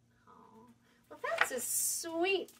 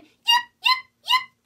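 very high, much louder yipping call with a laugh; loud fox yip; 15 dB louder, slower than usual. Acted. Little fox arrives at the barn, yelping loudly. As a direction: yelp it out at the barn door, laughing. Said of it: "Little Fox called again".

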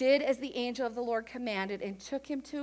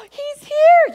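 much higher and louder, bursting with joy; excited cry; 11 semitones higher, 16 dB louder than usual. Acted. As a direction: shout the news in delight. Announcing the baby is the Savior.

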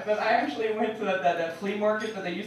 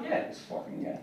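put-on sheepish kid; 8 dB quieter than usual.

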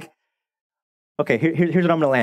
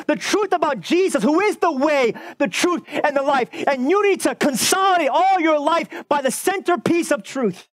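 raised, driving preacher's voice; preaching at full force; 8 semitones higher than usual. Acted. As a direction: build the sermon to its fervent peak. Preaching climax.

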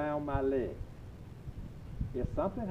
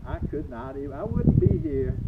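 emphatic outburst; 12 semitones higher, 8 dB louder than usual. Real, not acted. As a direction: stress the near-death punchline loud. Dramatic near-death realization.

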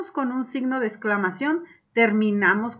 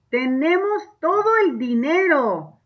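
higher, louder, slow gloating; exclaimed; 5 semitones higher, 5 dB louder, slower than usual. Shown as a demonstration. Teacher voices robbers gloating over the money. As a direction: savour the stolen money. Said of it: "es un signo de exclamación".

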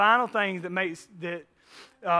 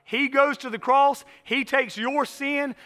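slow, louder emphatic preaching; 5 semitones higher, 3 dB louder than usual. Acted. Pastor pressing the gospel on his church, slow emphatic preaching. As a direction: drive each short line home slowly.

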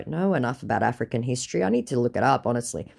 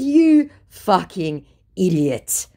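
loud, slow, higher-pitched scolding; exasperated insult; 4 semitones higher, 6 dB louder, slower than usual. Retold or imitated. Woman insulting the scammer, exasperated. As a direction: spell out the insult with weight.

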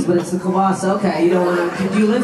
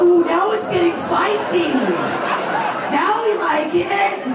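high, slow gleeful tease; mock delight; 10 semitones higher, slower than usual. Comic teasing the couple, high and gleeful. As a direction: drag it out like juicy gossip.

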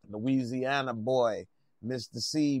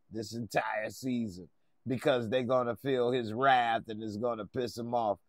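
normal talking voice

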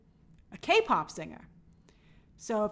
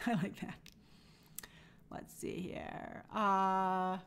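muttered to self; 5 semitones lower, 10 dB quieter than usual. Real, not acted. Scanning chat, muttering to self.

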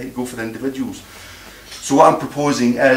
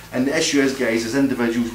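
ordinary talk, nothing marked

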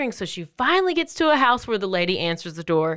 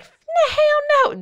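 very high, loud, drawn-out mock refusal; comic outraged refusal; 5 dB louder, slower than usual. Drivers refusing to stop for her, high comic outrage. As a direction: stretch it out in a hammed-up squeal.

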